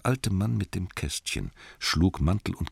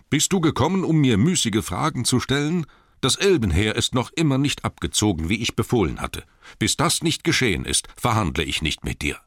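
raised, indignant dwarf king; indignant rebuff; 7 semitones higher, 4 dB louder than usual. A narrator's reading. Thorin refuses to negotiate with Bard, indignant. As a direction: rebuke him in a high, forceful voice.

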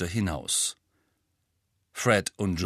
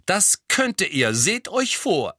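higher, louder and slower, indignant; girl scolding the twins, higher, louder and slower; worked up; 9 semitones higher, 4 dB louder, slower than usual. A narrator's reading. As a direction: warn them with rising indignation. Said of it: "erregte sich Hermine".

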